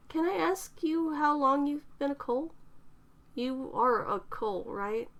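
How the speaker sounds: put-on a self-conscious young woman, mortified; lightly voiced young woman near tears; embarrassed young woman. A narrator's reading. Eterna asks timidly.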